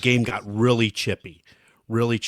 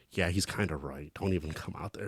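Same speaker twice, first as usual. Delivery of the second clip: dry referee aside; 6 semitones lower, 13 dB quieter than usual. Retold or imitated. Referee voiced in story.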